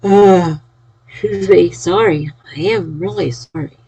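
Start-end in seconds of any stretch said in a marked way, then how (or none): 0.0-0.6 a loud yawn pushing through the sound; big yawn. Real, not acted. Streamer yawning at chat late at night, loud yawn. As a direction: let a real yawn take over the word. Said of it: "I know you're looking at me yawning".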